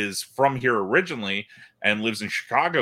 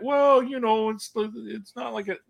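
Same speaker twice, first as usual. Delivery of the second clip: a higher, lightly put-on Canadian accent; 9 semitones higher than usual. Clerk voicing a stammering customer in a Canadian accent. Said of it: "That's a nice Canadian accent".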